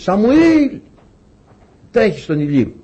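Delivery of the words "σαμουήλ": high, drawn-out call of a name; imitated divine summons, pitched up. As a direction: call to someone far off. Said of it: "φωνάζει ο Κύριος".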